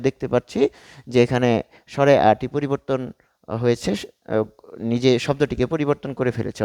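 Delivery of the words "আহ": normal talking voice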